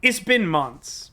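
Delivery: normal talking voice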